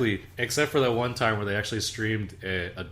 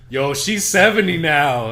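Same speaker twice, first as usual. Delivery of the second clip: chat retort; 5 semitones higher, 9 dB louder, slower than usual. Retold or imitated. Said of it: "everyone's like".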